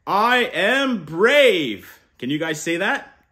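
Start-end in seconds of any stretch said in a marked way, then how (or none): 0.1-2.0 slow, loud, higher proud declaration; proud affirmation. Shown as a demonstration. Uncle modelling the affirmation for kids, slow and proud. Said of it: "we start the week with".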